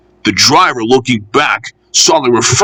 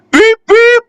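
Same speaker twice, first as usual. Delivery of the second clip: very high, louder beeping whistle; shrill mock train whistle; imitated whistle; 5 dB louder than usual. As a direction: toot like a little engine whistle. Engine whistles at the stations.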